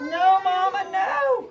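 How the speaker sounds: high, pleading no; scared duckling cry. Acted. Last duckling refusing Mama, high scared plea. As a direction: beg like a frightened duckling. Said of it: "cried little Crack".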